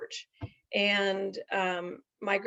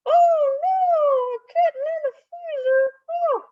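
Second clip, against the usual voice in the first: much higher, louder, crying puppet voice; distressed puppet; 7 dB louder than usual. Acted. Puppet hearing the kitten was frozen, crying puppet wail. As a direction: wail it like a horrified little puppet.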